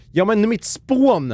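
high, loud, drawn-out exclamation; exasperated cry. Real, not acted. Gamer scolds himself over a blunder, exasperated cry. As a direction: wail it out at your own blunder.

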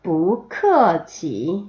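뿌크어치 very slow, ending in a long rise; tone drill. Shown as a demonstration. Teacher modelling the whole phrase, 뿌크어치 slow and stretched. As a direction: model every syllable of 뿌크어치 slowly. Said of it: "마지막".

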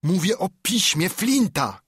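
high, exasperated shout. A narrator's reading. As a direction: shout it impatiently at the man upstairs. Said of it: "krzyknął".

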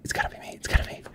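hushed hopeful whisper; pleading under breath. Real, not acted. Awaiting winner announcement.

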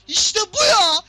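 very loud yell, voice cracking; triumphant shout. Real, not acted. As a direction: scream it as the goal goes in. Goal, full shout. (coşkulu bağırış)

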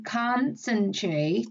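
"Concentrate" very slowly, syllable by syllable; teacher's drawn-out model of "Concentrate"; short O demo. Shown as a demonstration. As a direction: sound out "Concentrate" to show its first vowel. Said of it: "Chúng ta gọi âm này là âm O ngắn".

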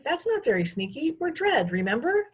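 put-on high, scolding child. A narrator's reading. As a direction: scold her, high and bright. Shay scolds Sprocket.

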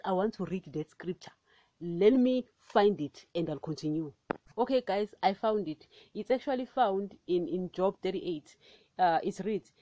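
ordinary talk, nothing marked

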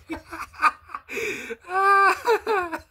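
high shrieking burst of laughter; a loud, helpless high laugh. Real, not acted. Reacting to ridiculous video.

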